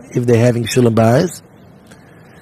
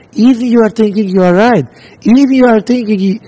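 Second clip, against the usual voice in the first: high, fast complaining husband voice; whiny husband; 8 semitones higher, faster than usual. Retold or imitated. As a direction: squeak it out like a fed-up husband.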